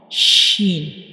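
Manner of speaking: SHEEN with a loud, strong sh, falling at the end; teacher's firm, careful model of "sheen"; pronunciation demo. Shown as a demonstration. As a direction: push out the sh, then let "sheen" drop. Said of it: "this is the pronunciation of".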